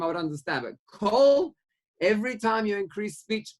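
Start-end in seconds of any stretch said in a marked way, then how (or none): 0.7-1.5 kol punched much higher and louder; stress on kol. Shown as a demonstration. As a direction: hit the word kol hard, arguing. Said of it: "Kol, if it would say".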